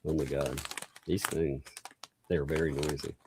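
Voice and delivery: talking through a mouthful; chewing while speaking; mouth full. Real, not acted. Crunching chips close to mic.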